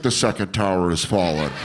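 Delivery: low, grave newsreader line; news footage voice. Retold or imitated. Comic imitating the spliced news audio, low and grave. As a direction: drop into a solemn news report. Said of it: "And then randomly it's like".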